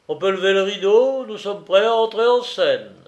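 high, loud and rousing; triumphant announcement. A narrator's reading. Benjamin marches his companions off, loud triumphant announcement. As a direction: proclaim it like a showman before curtain-up. Triumphant call to start the show.

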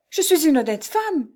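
high-pitched desperate insistence; desperate protest. A narrator's reading. Jeanne defends her honour to the stranger, desperate. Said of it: "avec l'énergie du désespoir".

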